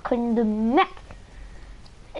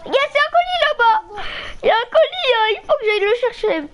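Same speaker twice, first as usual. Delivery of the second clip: excited; 9 semitones higher than usual. Real, not acted. Spots a loot crate, excited.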